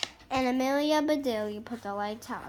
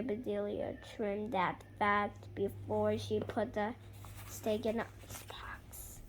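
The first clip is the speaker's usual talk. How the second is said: whisper; 3 semitones lower, 10 dB quieter than usual. A narrator's reading. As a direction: let it fade to a whisper. Whispered narration.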